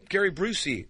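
ordinary talk

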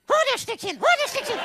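high, loud fetch command; dog command. Pretend. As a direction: order him to fetch like a dog. (hohes, lautes Hundekommando)

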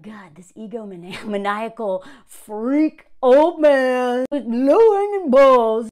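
slow, contemptuous inner voice; imagined disgusted thought. Pretend. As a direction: sneer it slowly in private disgust. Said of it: "She's probably like".

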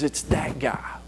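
soft unvoiced whisper; whispered reveal; hushed whisper. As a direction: lean in and breathe it. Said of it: "And Jesus says".

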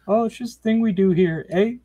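high, breezy quoted answer ending in eh; Michigan relatives' reply. Retold or imitated. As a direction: pitch it up as an unbothered relative. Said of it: "they, they use A".

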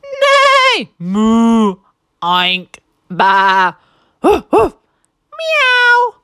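loud, high neighs, moos and meows; a deafening barnyard chorus; animal racket. A narrator's reading. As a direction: shout each animal noise in turn. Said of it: "let out a deafening sound".

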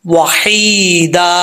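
very slow वहीदा with a light thin ह; correct recitation. Shown as a demonstration. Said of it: "इसको भारी sound में नहीं पढ़ना". (हल्के ह के साथ धीमा वहीदा)

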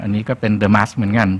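ordinary talk, nothing marked

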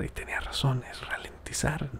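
hushed, reluctant agreement; whispered admission. Real, not acted. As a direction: whisper ralentizar as a grudging concession. Conceding quietly.

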